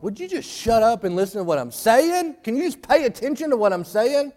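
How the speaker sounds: high, fed-up demand to be heard; exasperated plea. Retold or imitated. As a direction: sound worn out from repeating yourself. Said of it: "you're like".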